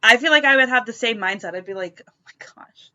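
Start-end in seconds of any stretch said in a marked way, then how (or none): 2.1-2.9 whispered in hushed embarrassment; embarrassed whisper in public. Retold or imitated. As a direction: hiss it under your breath. Imagined embarrassed reaction.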